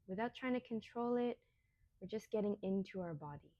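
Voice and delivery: unhurried meditation voice, long pauses between phrases; guided meditation. Real, not acted. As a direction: guide them gently through each breath, softly. Leads a guided breathing meditation.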